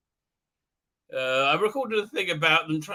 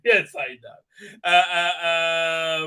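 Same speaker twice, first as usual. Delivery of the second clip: usual speaking voice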